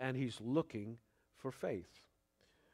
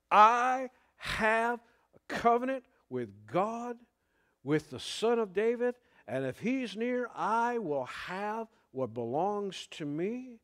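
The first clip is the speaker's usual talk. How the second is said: bold declaration; 6 semitones higher, 5 dB louder than usual. Retold or imitated. Blind man declaring his claim.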